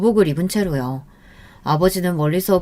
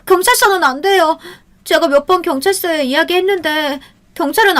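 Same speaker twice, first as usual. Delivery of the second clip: put-on high, loud pleading girl; frightened girl insisting the police won't help; desperate girl; 10 semitones higher, 4 dB louder than usual. A narrator's reading. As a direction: plead in distress, voice pitched up.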